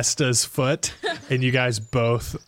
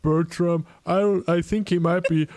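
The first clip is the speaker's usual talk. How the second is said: higher put-on ogre voice, timid; a scared giant admits it; 6 semitones higher than usual. Ogre telling the party about the bully, timid.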